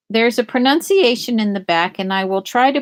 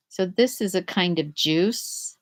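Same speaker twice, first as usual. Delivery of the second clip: usual speaking voice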